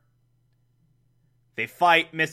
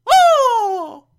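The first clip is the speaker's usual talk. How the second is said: very high, loud hooting squeal; hammed-up piercing coo of delight; Aunt Harriet; 8 dB louder than usual. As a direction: hoot a sustained falsetto "oh". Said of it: "And Aunt Harriet's like".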